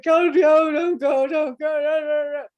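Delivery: loud, high wailing plea; acted cry of protest. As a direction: beg them, pitched high and pushed out. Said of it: "I cried and cried and cried".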